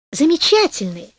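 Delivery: радостный ответ, чуть выше; обрадуйся подарку; обрадованная жена. A narrator's reading. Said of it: "ответила обрадованная жена". (радостно)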